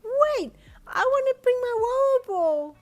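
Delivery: put-on high, loud, slow child protest; child arguing it's daytime; insistent little girl. A narrator's reading. Daughter protesting bedtime to dad, loud child voice. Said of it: "she suddenly announced".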